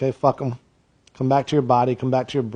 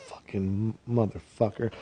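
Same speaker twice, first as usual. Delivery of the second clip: under-the-breath curse, softer; muttered curse; 5 semitones lower, 13 dB quieter than usual. Retold or imitated. Clerk cursing after the customer leaves, under breath. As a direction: swear quietly once they've gone. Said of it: "you're like".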